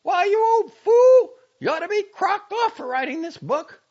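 very high, loud tirade; furious scolding. A narrator's reading. Girl berating the old doctor over his book, furious. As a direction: lay into him at full volume.

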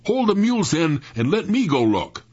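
high, forceful command; calling out orders. A narrator's reading. Sam handing over the reins, ordering loudly. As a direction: call it out urgently.

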